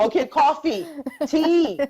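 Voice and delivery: loud, high-pitched playful suggestions; playful exclaiming. Real, not acted. Offering drinks to a friend who doesn't drink, playful exclaiming. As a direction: call out each drink, pitched right up.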